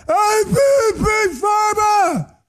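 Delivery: screaming. Retold or imitated. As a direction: yell it like a raving lunatic, stretched out. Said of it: "He's screaming again".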